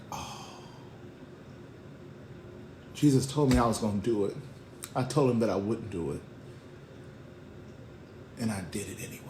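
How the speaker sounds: hushed, subdued acted regret; remorseful. Peter realizing he denied Jesus, quiet heavy remorse. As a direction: let the guilt sink in with long pauses. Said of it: "imagine how bad he felt".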